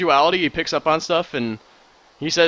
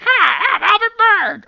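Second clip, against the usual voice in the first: squeaky, much higher, louder parody of a high voice; mock high voice; 9 dB louder, slower than usual. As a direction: squeak it out like a cartoon of yourself. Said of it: "I don't have to pitch my voice higher".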